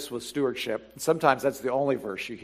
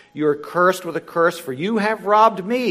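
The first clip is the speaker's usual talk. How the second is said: ordinary talk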